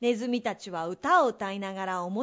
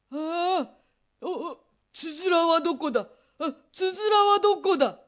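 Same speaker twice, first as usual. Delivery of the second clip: frightened searching; 6 semitones higher, 5 dB louder than usual. Acted. Lost in dark.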